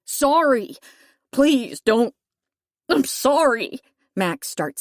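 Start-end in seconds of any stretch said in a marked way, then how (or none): 0.1-0.8 higher and louder, frightened second sorry; beg, voice rising in fear; panicked pleading sorry; desperate plea. Acted. Captive begs forgiveness. 1.3-3.8 higher, louder pleading; desperate begging apology; frightened begging. Acted. Captive begs, then sobs.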